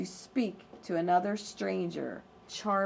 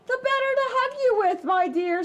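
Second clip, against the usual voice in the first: much higher, fast fake granny; wolf as granny; 5 dB louder, faster than usual. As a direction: hurry the sweet lie.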